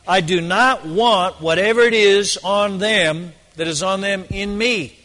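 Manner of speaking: usual speaking voice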